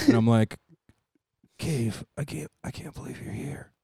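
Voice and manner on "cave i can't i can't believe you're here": very soft, movie-drama hush; hushed dramatic. Pretend. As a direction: breathe it out in disbelief. Hospital movie scene, dramatic.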